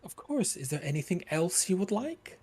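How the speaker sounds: high sugary voice talking down; doctor sweetly mocking her patient; patronizing doctor. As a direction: coo at him like a child.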